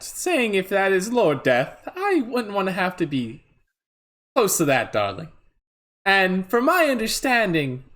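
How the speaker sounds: theatrical diva voice. Acted. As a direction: sweep in like a glamorous diva.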